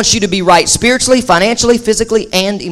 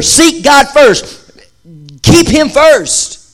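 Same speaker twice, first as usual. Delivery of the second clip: higher, slow, drawn-out "Seek God first"; slow emphatic preaching; 5 semitones higher, slower than usual. Acted. Preacher urging the congregation, slow and raised. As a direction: lean on every word, slowly. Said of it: "preaching emphasis".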